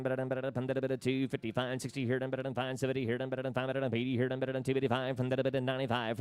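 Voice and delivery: rapid rolling auction chant; auctioneer. Acted. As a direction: rattle off bids in a fast singsong. Livestock auction bid calling.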